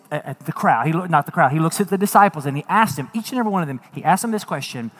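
ordinary delivery

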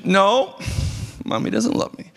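put-on sulky child refusing comfort; a hint of a pouty daughter; pouting little girl. Pastor voices pouting little girl.

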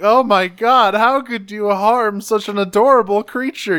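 high, loud scandalized scolding; hammed-up shocked reproach; mock outrage. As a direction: act the appalled bystander, laying it on thick. Retold shocked crowd scolding her.

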